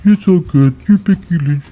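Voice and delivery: timid caricature of Raquel giving in to Becky; imitating Raquel shrugging. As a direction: play Raquel backing down sheepishly. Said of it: "She's like".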